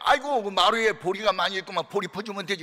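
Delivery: a lively country mother; mother's voice. Retold or imitated. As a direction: play the mother, pleased and practical. Quoting the mother in story.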